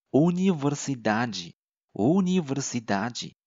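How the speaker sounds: universidade slowly, ending in "djee"; Brazilian G sound. Shown as a demonstration. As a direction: model universidade twice, final de as "jee". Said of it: "同理，还有一个叫大学的单词". (慢速示范universidade，词尾de发“ji”)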